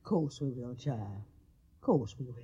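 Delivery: soft, low reassurance; tender. Acted. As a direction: soothe the frightened girl. Mammy comforting Jo Beth.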